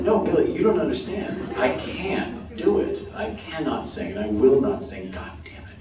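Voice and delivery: insistent mock-desperate protest, dropping away on the final curse; exasperated refusal. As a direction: plead high and loud, then dig in stubbornly. Acted refusal to a director.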